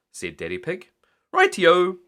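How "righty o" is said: high loud chirpy reply; cheery Miss Rabbit. A narrator's reading. Miss Rabbit agreeing to fly to the fair, high and chirpy. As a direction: chirp it brightly. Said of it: "said Miss Rabbit".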